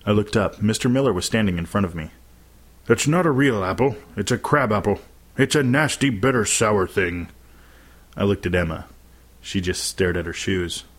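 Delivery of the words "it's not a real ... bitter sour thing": lightly voiced male teacher cautioning a child; a touch of character voice, explaining why not; teacher's warning. A narrator's reading. Teacher stopping a boy eating a crab apple, cautioning.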